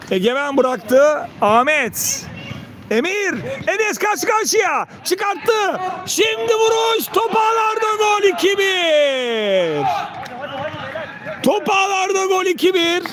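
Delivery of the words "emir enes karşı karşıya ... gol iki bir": rising to a screamed goal call; screaming football commentator. Real, not acted. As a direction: yell the goal, full throat.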